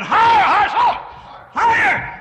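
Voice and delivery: high, loud repeated cry; soaring shouted call. Acted. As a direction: ring the word out upward. Preacher calling out higher.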